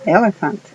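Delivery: elephant with both E's clearly sounded; English word elephant. Shown as a demonstration. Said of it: "se pronuncia". ("elephant" con las dos E que suenan)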